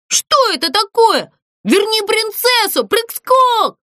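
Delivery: high-pitched indignant shout. A narrator's reading. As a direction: cry out high, demanding an answer. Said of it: "закричал ящик". (возмущённый крик высоким голосом)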